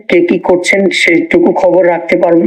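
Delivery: ordinary talk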